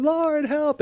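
drawn-out, high mock wail for rescue; mock scream. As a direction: imitate a panicked scream at normal volume. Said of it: "Jehoshaphat starts screaming".

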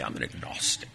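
hushed, lofty know-it-all mock; a breathy, superior mock of the agnostic; mock intelligent tone. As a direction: say it as if it sounds very clever. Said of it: "they say it in such an intelligent way".

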